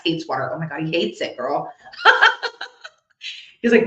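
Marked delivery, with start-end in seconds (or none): 1.9-2.9 a sudden high, loud laugh; burst of laughter. Real, not acted. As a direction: break into a real, high laugh. Medium laughing at dad's dislike of water.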